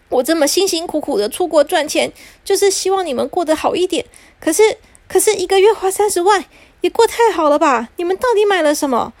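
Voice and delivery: a hard-working dad fed up with the spending; exasperated father. Acted. Father lecturing the family on their spending, exasperated.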